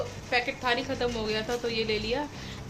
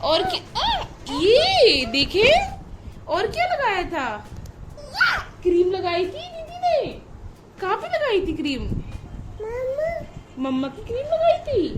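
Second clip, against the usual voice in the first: delighted praise; 6 semitones higher than usual. Real, not acted. Mother gushes over her toddler's painted nails, high and excited. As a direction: gush over the little one's nail paint.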